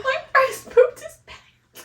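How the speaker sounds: high, slow, loud words through laughter; cracking up. Real, not acted. Mother revealing son's accident, cracking up. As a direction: squeeze it out, crying with laughter.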